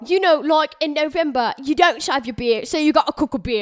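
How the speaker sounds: put-on playful English accent; mock English accent. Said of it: "make up with the English accent".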